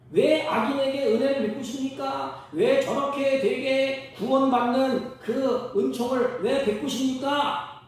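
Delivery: high, loud, slow indignant protest; Jonah protesting. Acted. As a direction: hammer each why at God. (높고 크게 천천히 따지는 요나)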